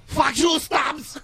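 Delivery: hammed-up angry shout, high and loud; mock angry yell. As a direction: yell it at him in fake rage. Mock angry outburst at Stubbs.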